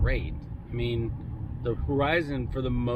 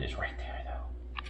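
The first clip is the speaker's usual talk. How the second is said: a hushed teasing aside; whispered tease; 10 dB quieter, slower than usual. Real, not acted. Owner whispering to the dog about the chicken, hushed tease.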